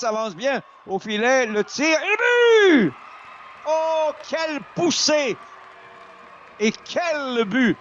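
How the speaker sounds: rising to a roaring goal call; excited sports commentary. Real, not acted. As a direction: shout the goal, thrilled and high. Goal called live.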